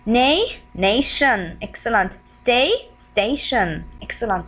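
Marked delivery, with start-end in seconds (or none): 0.1-1.6 "Nay" held and rising, then "nation" falling; "Nay" as a prompt, "nation" completed clearly; syllable prompt, then word. Shown as a demonstration. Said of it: "This is the shin sound". 2.2-3.8 "Stay" held and rising, then "station" falling; "Stay" as a prompt, "station" completed clearly; syllable prompt, then word. Shown as a demonstration. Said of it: "This is the shin sound".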